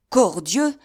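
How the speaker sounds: loud, high outburst of anger; angry cry. A narrator's reading. Athos shouting an oath at the shooters. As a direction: Athos swears aloud at the hidden shooters. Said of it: "s'écria Athos".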